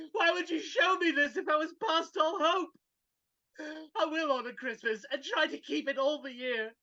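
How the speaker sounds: normal talking voice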